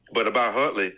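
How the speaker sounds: ordinary delivery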